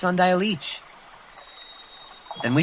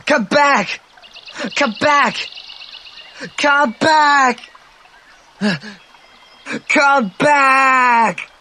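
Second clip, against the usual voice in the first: much higher, louder yell that builds; desperate shout; 11 semitones higher, 5 dB louder, much slower than usual. Acted. Boy yelling after a passing ship, desperate shouts. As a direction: scream after the ship with everything.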